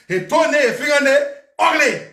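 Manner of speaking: mock-outraged insult; emphatic insult. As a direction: build it up to a shouted ugly. Retelling his insult, building.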